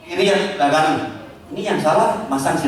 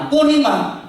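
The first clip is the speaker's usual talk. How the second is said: high, playful wife voice; a wife who has already decided, pitched high; mock wife insisting; 9 semitones higher, slower than usual. Wife overrules husband on dress colour, high and insistent.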